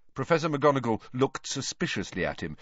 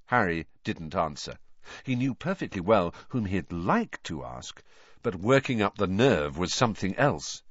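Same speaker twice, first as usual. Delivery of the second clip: usual speaking voice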